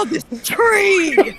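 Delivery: high, slow, exaggerated wonder; mock awe. Friend imitating a streamer gawking at the graphics, mock awe. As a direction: gush over a tree in fake amazement.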